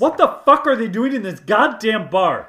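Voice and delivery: loud, high, outraged yell at the screen; shouting in genuine outrage at the goons; outraged outburst. Real, not acted. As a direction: blow up in real disbelief. Outraged reaction to goons returning.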